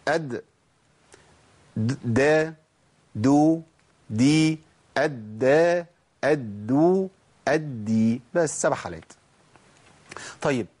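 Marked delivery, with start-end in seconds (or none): 0.1-8.2 أد، دا، دو، دي said fast with a crisp dal; quick qalqala drill. Shown as a demonstration. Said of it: "أقولهم تاني بشكل سريع".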